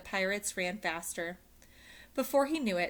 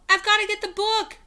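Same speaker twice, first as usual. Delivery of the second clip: calling out; 12 dB louder than usual. Acted. Jack calling back.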